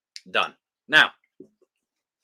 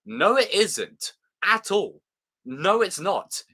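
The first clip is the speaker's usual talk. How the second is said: emphatic denial; 5 semitones higher, slower than usual. Real, not acted. Emphatic rejection of accent claim.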